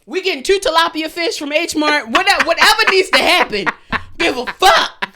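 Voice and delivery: loud giddy threat rant; loud hyped plans. Real, not acted. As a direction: egg each other on, laughing. Joking revenge ritual plan.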